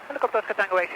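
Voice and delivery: ordinary talk, nothing marked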